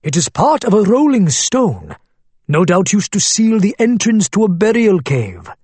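put-on thick Arabic accent, old shopkeeper. A narrator's reading. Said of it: "deep voice in a thick Arabic accent".

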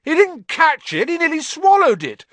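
high, loud outraged howl; howling protest. Acted. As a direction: howl it at the referee in fury. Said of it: "Flint was still howling".

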